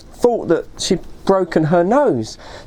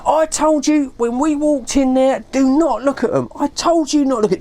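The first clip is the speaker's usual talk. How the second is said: higher-pitched, exasperated telling-off; worked up; 6 semitones higher than usual. Retold or imitated. Dave telling his friend off for looking, worked up. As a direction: scold a friend, mildly indignant. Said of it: "He was so worked up".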